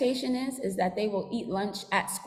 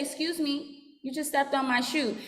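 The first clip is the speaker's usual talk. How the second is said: kindly; 4 semitones higher than usual. Shown as a demonstration. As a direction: say it gently and politely. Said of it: "We say".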